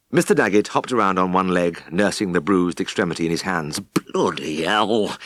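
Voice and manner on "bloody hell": slow pained moan. A narrator's reading. Farmer nursing his crushed foot, moaning slowly. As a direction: groan it through the pain. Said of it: "he moaned".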